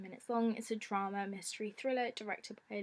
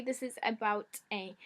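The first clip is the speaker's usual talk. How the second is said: ordinary delivery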